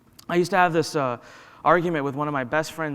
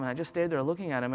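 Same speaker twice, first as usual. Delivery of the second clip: usual speaking voice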